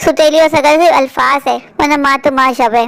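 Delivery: calm closing moral for viewers; moral narration. A narrator's reading. Viewers hear the moral after the skit, told calmly. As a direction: speak the lesson gently, like a storyteller.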